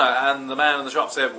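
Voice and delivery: normal talking voice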